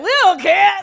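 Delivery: loud laughing, high and careless; laughing shrug. Retold or imitated. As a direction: friends at the movie laugh it off loudly. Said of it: "We're like".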